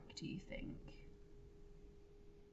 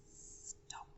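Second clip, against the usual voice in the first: tiny whispered stop; barely voiced dramatic stop; 13 dB quieter than usual. A narrator's reading. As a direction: breathe the sign's word. Reading Greta's sign.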